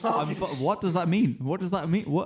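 loud, laughing what does that mean; laughing disbelief. Real, not acted. Player baffled by the chili rum, loud and laughing. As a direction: laugh through the confusion.

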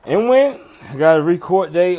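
sudden high loud question; surprised. Real, not acted. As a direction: snap out a startled question.

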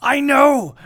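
loud high exclamation; excited shout. Real, not acted. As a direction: blurt it out gleefully. Bursts out.

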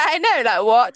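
ordinary talk

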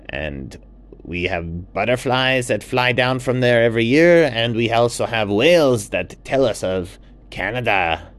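a warm host showing off his jungle; toucan Juan. Acted. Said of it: "And Juan the toucan said".

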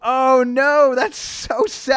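high, drawn-out lament; loud dismay. Real, not acted. Reacting to the burned sandwich shop, dismayed. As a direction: wail it slowly, high up.

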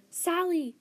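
loud, high-pitched cry; anguished yell. A narrator's reading. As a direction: scream the dog's name in distress. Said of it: "Luna yelled".

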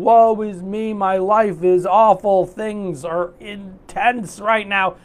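slow, whiny woe-is-me voice; drawn-out mock complaint; mock self-pity. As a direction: ham up feeling sorry for yourself. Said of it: "Instead of going".

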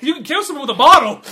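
high, loud justification with laughter; mock indignant. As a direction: protest loudly in an imitated voice. Acted defensive retort from film.

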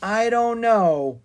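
slow, high, drawn-out worry; frowning doubt. A narrator's reading.

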